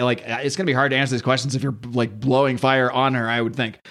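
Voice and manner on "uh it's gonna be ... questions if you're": usual speaking voice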